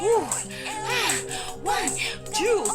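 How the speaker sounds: plain reading voice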